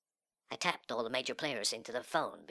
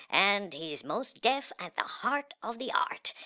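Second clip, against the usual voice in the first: higher, louder, grandiose sales spiel; hyped-up art pitch; 7 semitones higher, 6 dB louder than usual. Acted. Hostage-taker pitching his art to the phones listening in, hyped up. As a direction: sell it big to a listening crowd.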